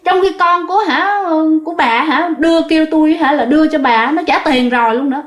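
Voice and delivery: impatient and cross, pushing the ticket; irritated. Retold or imitated. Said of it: "bà vé số này quạo".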